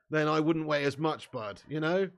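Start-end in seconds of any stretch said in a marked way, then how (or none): none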